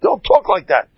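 high, loud acted telling-off; screaming scolding. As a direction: scream a rebuke at your assistant. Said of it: "The Rav screams at him".

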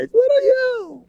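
high, slow, tiny mocking voice; mocking little you. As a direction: shrink him down with a squeaky taunt. Belittling the complaining husband.

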